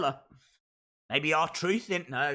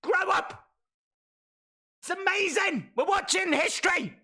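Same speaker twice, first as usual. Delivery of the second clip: excited outburst; 8 semitones higher than usual. Real, not acted. Telling off chat, hyped.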